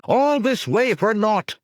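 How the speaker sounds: high, loud and slow despairing cry; cried out. A narrator's reading. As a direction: wail it out to the whole camp. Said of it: "another cried".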